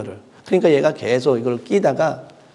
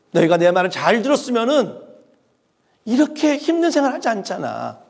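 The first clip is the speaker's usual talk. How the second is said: mock rebuke; 5 semitones higher than usual. God rebuking the Israelites for not listening, scolding. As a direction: scold the people as a stern, irritated God. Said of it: "라고 하나님께서는 말하지 않았어요".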